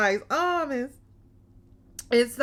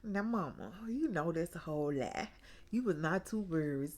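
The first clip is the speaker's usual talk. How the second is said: much softer, under the breath; muttered inner thought; 5 semitones lower, 12 dB quieter than usual. Retold or imitated. Her own doubting thought about mama, said much softer. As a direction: say it quietly, to yourself. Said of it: "I'm thinking".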